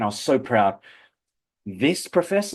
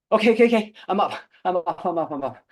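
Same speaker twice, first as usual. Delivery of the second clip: put-on panicked kid; 8 semitones higher, faster than usual. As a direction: blurt it out jumping up. Kid jumping out of bed.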